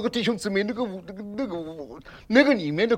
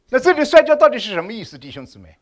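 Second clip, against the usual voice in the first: ordinary talk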